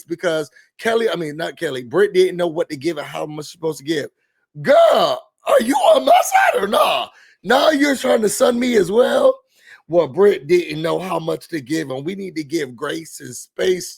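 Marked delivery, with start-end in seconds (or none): 4.6-9.3 shrieking high and loud in disbelief; indignant cry. Real, not acted. To her co-host for taking the other side, a shrill outraged cry. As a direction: cry out like you've been betrayed.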